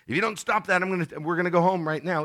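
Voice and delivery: put-on high, loud, fast threat to the kids; a mother at the beach yelling an empty threat at her kids; mock yelling mother. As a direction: shout like a fed-up mom at the beach. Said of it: "and the mother says".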